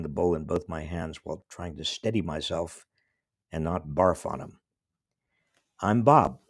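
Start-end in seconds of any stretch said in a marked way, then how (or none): 5.8-6.4 nervous, halting introduction; stammering. Retold or imitated. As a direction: stammer it out, seasick. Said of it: "I stammered".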